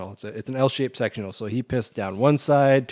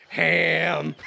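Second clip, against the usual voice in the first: ham horn; 4 semitones higher than usual. Retold or imitated. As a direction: honk it like a horn. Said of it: "It literally just goes".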